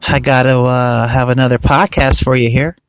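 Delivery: low, slow, droning monotone; deadpan fake excitement, sounding half asleep; mock boredom. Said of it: "you can hear the excitement in my voice".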